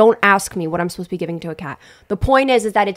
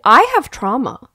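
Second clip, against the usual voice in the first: mock dramatic; 6 semitones higher, 6 dB louder, slower than usual. Mock-dramatic cat complaint.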